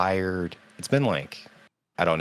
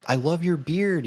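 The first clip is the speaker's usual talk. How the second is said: much higher mock compliment; imitating Gimli actor; 12 semitones higher than usual. Fan mimics the actor complimenting her beard, high. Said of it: "And then he was like".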